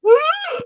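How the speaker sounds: high, loud "ah" cry; imitated excited scream. Boy spotting Doug at the bar, imitated high scream. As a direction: let out a sudden acted shriek.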